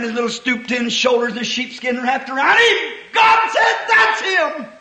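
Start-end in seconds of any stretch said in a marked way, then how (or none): 2.6-4.7 high, ringing proclamation; exultant preaching cry. Real, not acted. Preacher announcing God's choice of David, high exultant cry.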